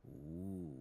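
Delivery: normal talking voice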